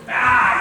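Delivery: loud, high frustrated "Ah"; angry roar. Acted. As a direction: bellow in defeat. Monster storms off enraged.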